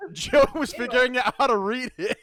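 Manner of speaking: loud laughing through the words. Real, not acted. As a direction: talk while cracking up. Laughing at misread line.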